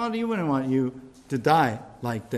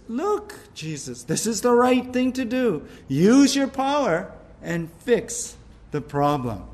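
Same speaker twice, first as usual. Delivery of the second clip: Satan coaxing, a bit higher, pushing hard on right; 5 semitones higher than usual. Acted. Preacher voicing Satan urging Jesus to use his power, higher and pushing. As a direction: lean in and push him to act.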